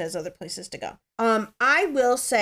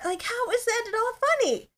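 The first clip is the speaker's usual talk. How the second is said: exasperated; 13 semitones higher than usual. Real, not acted. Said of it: "It's not funny".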